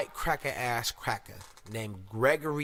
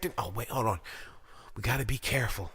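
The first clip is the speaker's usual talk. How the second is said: ordinary delivery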